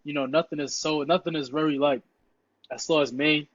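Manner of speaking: ordinary delivery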